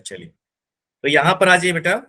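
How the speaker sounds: ordinary delivery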